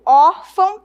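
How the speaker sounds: ÓRFÃO with an open first ó and a nasal ão; clearly sounded open ó in "órfão"; open O, nasal ending. Shown as a demonstration. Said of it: "eu tenho um som aberto".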